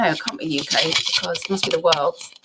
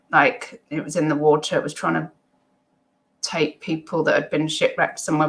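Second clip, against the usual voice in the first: ordinary delivery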